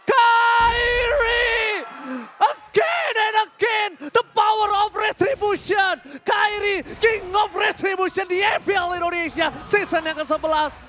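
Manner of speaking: loud, high triumphant shout; fighting game announcer. Real, not acted. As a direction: hail the champion like an arena announcer. Celebrating Kairi's steal. (seruan kemenangan penuh semangat)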